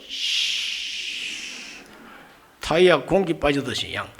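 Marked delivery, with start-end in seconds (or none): 0.1-1.8 unvoiced hiss like a tyre going flat; hiss of escaping air. Pastor miming angry Jonah's breath, a hiss. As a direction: let the air out in a long sh. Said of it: "입에서 뭔가 쉬 하고 나오는 거예요".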